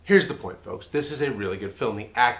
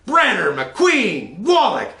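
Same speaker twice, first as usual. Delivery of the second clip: "Brenner, McQueen," stretched slow, much higher and louder, "Wallach!" yelled high; hammed-up excited roll call; gushing names; 10 semitones higher, 9 dB louder, much slower than usual. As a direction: cheer the cast like a giddy fan. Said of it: "You show a little enthusiasm".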